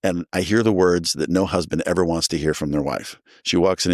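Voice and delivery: usual speaking voice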